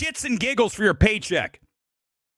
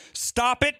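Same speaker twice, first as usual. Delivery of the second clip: ordinary talk, nothing marked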